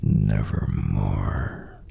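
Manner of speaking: low, slow, ominous croak; raven voice. Acted. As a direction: drag it out like a dark bird. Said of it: "Quoth the raven".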